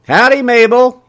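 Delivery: high, loud, cheery howdy; beaming greeting. A narrator's reading. Shopkeeper greets Mabel as she walks in, beaming. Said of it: "he beamed".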